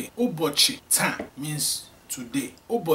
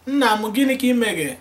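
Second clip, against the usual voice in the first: loud, higher call to father; 7 semitones higher, 7 dB louder than usual. Shown as a demonstration. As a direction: call out to dad across the house. Calling out to father.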